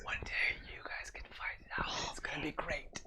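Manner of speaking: hushed unvoiced whisper. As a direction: keep it to a whisper. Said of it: "you guys probably don't need to actually whisper".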